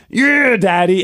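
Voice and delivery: imitating a daughter's excited cheer; fired-up little girl. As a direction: cheer along like a child. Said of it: "she was getting fired up".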